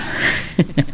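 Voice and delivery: short airy laugh at a fond memory; soft laugh. Acted. Recalling their old outings together, airy laugh. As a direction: laugh gently while remembering.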